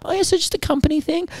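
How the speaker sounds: mock the check-in lady flirting with businessmen, high and sweet; flirty airline lady. Mocking the Qantas lady chatting up businessmen, high flirty voice. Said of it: "And she's like".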